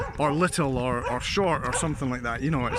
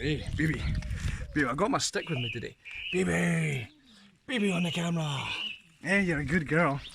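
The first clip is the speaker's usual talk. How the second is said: talking to the dog; 4 dB quieter than usual. Real, not acted. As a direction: coo at your dog, softer.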